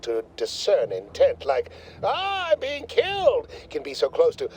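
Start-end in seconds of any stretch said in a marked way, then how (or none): 2.0-3.5 high-pitched, drawn-out mock scream. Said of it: "Sometimes with screaming, it can be difficult".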